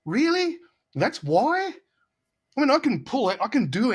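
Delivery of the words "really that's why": much higher, mock disbelief; a retold stunned question to the producer; incredulous. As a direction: ask it like you can't believe the reason. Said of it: "I'm like".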